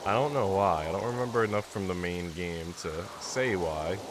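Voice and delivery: ordinary talk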